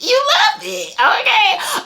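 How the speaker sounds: high, laughing, teasing squeal; giggly teasing; laughing tease. Real, not acted. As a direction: tease her through laughter.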